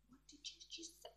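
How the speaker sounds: very soft, quick, whispered retort; mock-offended whisper, barely voiced; hushed indignation. Pissed-off worker answering her boss's joke, hushed mock indignation. Acts out her angry reaction.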